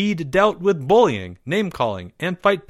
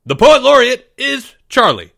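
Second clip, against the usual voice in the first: firm declaration; 11 semitones higher, 9 dB louder than usual. A narrator's reading. Teacher firmly declaring the winner.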